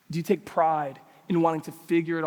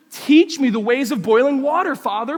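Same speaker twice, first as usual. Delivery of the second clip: higher, loud exaggerated plea; mock teachable pupil; 6 semitones higher, 8 dB louder than usual. Teenage son begging dad for instruction, mock reverence. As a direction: play a hammed-up eager disciple. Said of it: "Palms up".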